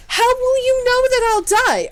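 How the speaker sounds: high, loud, alarmed cry; shocked outcry. Acted. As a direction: cry out in disbelief. Shocked outcry at death prophecy.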